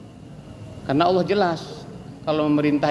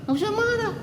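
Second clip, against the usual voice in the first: high coaxing reassurance. Pretend. Preacher soothing an insulted listener.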